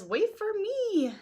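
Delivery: high, slow fussy droid plea; C-3PO calling. Acted. C-3PO begging the others to wait, high fussy whine.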